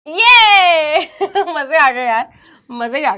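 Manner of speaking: long drawn-out yay with a laugh; gleeful cheer. Real, not acted. Teacher celebrating the class carrying on, gleeful cheer. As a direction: stretch it out, breaking into laughter.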